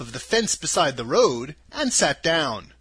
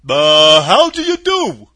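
husky; 13 dB louder, slower than usual. A narrator's reading. Scarecrow greeting Dorothy, loud and husky. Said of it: "in a rather husky voice".

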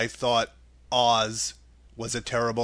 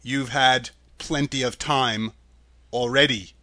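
ordinary delivery

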